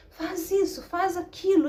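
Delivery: put-on high, loud little-girl commands; rude, bossy child. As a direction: imitate a kid bossing siblings around. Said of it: "falar de maneira tão rude".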